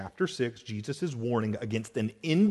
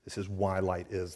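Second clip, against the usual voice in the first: ordinary delivery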